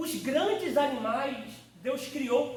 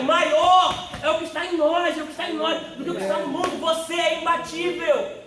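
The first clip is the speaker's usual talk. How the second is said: full-force preaching; 5 semitones higher, 9 dB louder than usual. Acted. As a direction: declare it like a battle cry.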